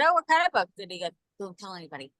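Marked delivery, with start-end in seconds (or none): 0.0-0.7 lightly voiced child, higher pitch; curious schoolkid. Voicing son's curious friend. 1.4-2.1 hushed, imitated child's confidence; confiding a secret.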